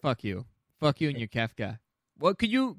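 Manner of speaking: ordinary talk, nothing marked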